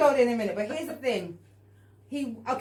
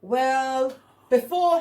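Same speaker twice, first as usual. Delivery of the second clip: mock stalling; 3 semitones higher, 6 dB louder, much slower than usual. Acts his evasive reply to question.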